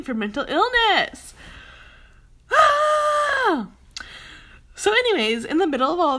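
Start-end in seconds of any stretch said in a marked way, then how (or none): 2.5-3.7 high, loud groan of frustration; exasperated shouted groan. Real, not acted. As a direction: let out a loud groaning sigh.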